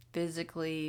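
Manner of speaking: usual speaking voice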